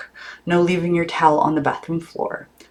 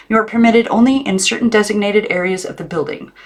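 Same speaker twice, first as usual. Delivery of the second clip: stern aunt; 6 semitones higher than usual. A narrator's reading. Aunt voiced stating rules.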